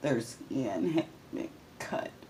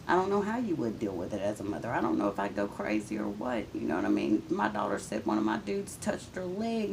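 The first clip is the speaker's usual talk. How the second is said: ordinary talk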